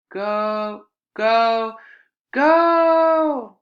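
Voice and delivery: building to a high, very loud yell; rising roar of a cheering crowd; crazed cheering. Acted. Crowd going crazy, cheering.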